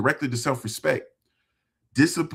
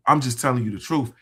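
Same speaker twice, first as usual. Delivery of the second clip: usual speaking voice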